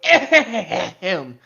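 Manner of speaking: usual speaking voice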